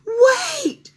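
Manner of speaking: high, loud urgent call; girl calls old woman back; crying out. A narrator's reading. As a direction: shout wait to stop her. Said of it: "she cried".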